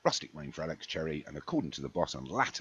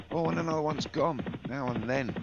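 a much higher, louder shocked call; a caller startled by yet another fall; surprised; 10 semitones higher, 5 dB louder than usual. Real, not acted. Commentator calling another faller to viewers, higher and louder in shock.

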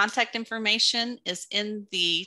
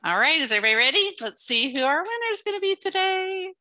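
excited giveaway hype; 3 semitones higher than usual. Real, not acted. Prize wheel spin, building suspense.

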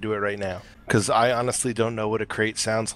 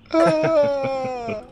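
high loud dying yelp; death cry; 5 dB louder than usual. Pretend. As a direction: scream like a slain creature. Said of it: "Was that crate?".

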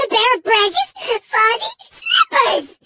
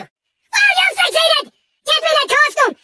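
angry outburst; 2 semitones higher, slower than usual. Acted. Engine ordering Crash to get the costume, enraged. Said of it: "trying to make me angry".

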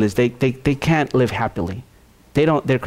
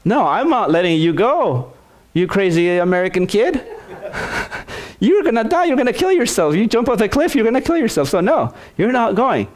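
much higher, insistent instructor refusing to let him jump; high, alarmed instructor forbidding the jump; 8 semitones higher than usual. Acted. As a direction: warn him off like a worried instructor. Instructor refusing a reckless kid.

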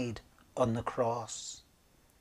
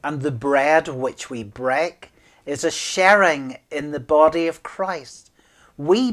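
louder, declaiming the communion words; solemn, louder liturgical declaration; liturgical proclamation; 7 dB louder than usual. Acted. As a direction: proclaim it from the altar.

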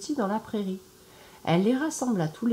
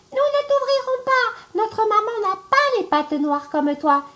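put-on much higher, louder childlike cry; little goat kids shouting; 8 dB louder than usual. The little goats shout at the wolf, high and loud. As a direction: yell it like tiny goats who caught him. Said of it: "crièrent".

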